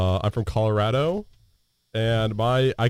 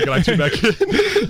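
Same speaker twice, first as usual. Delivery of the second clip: laughs through it, slower; chuckling while saying it; amused, breaking into laughter; laughing; 9 semitones higher, slower than usual. Real, not acted. Laughing about the oinking intro.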